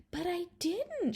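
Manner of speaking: Charlotta protesting. Acted. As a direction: insist gently she didn't do it. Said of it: "Charlotta explained".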